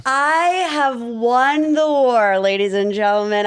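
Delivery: slow, drawn-out mock victory announcement; gloating podcast victory speech; triumphant declaration. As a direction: proclaim it grandly to the crowd, stretching each word.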